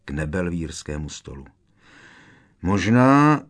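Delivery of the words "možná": drawn-out, rising, thinking aloud; musing. A narrator's reading. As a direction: let the word hang as an idea forms. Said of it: "zamyslela se Hermiona". (zamyšleně, protáhle)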